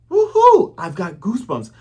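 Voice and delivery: very high, loud cheer; excited whoop. Retold or imitated. Luffy cheering about the Grand Line, loud high whoop.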